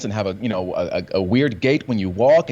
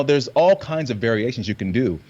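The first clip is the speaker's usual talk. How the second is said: usual speaking voice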